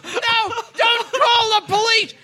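put-on frantic pleading young woman; pleading model. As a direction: beg him not to call. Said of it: "She goes".

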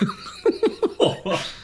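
real high-pitched laugh. Real, not acted. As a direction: laugh high over the lucky roll. Laughing at own dice roll.

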